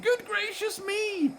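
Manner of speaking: very high astonished gasp; shocked exclaim. A narrator's reading. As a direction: clutch your pearls.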